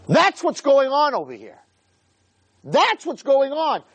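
high, emphatic declaration; impassioned preaching. Real, not acted. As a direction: drive the answer home with rising fervour. Preacher answers own question emphatically.

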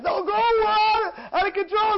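high, loud stretched-out cry; slow, wailing high-pitched yell; out-of-control shout. Real, not acted. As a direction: let it explode at full voice. Describes wild, uncontrolled zeal.

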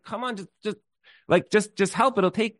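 high, halting plea; acted pleading. Stranger begs driver to take injured man to hospital, pleading. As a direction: beg haltingly, voice pitched well up.

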